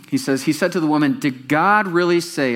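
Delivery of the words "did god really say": reading it out. Shown as a demonstration. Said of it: "read that with me".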